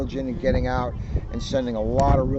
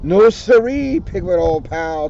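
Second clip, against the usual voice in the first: Tigger voice; 2 semitones higher, 6 dB louder than usual. Acted. Reader voicing Tigger to the kids, bouncy and loud. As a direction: boom it out like a bouncy tiger.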